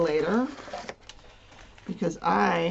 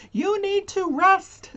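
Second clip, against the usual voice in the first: mock dog message; 11 semitones higher, 4 dB louder than usual. Dogs signalling her to rest.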